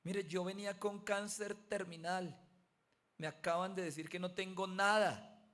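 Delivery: put-on young man showing his paper, overwhelmed; overwhelmed joy. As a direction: announce the miracle while still shaking. Young man announces he is healed.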